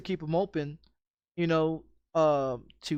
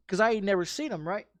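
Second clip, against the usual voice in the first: ordinary delivery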